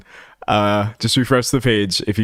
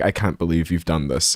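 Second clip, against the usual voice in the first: lower, softer, deadpan calm; 7 semitones lower, 4 dB quieter than usual. Retold or imitated. Streamer quoting the roommate meme, calmly deadpan. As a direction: say it with total calm, no anger. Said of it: "he just very calmly is just like".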